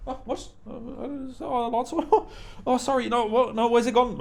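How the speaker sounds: softly high and flustered; mock confused buyer. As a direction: act out a flustered customer searching. Mocking a robbed customer.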